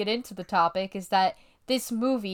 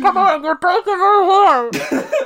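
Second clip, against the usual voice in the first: higher, louder, slow lovesick whine; mock pleading; 9 semitones higher, 8 dB louder than usual. Mock lovesick plea to a girl who said no, higher, louder and slow. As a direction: ham up the heartbroken suitor begging.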